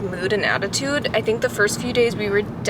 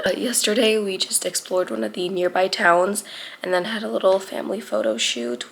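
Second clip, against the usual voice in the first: normal talking voice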